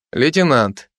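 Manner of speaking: ordinary delivery, nothing marked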